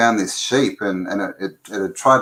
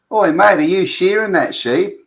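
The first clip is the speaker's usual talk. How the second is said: Kiwi bloke; 8 semitones higher, 4 dB louder than usual. Acted. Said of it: "and he goes".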